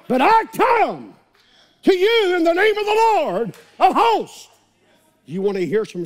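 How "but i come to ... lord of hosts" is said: preaching at full force. Acted. Preacher voicing David before Goliath, loud and soaring. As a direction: proclaim it like David facing the giant.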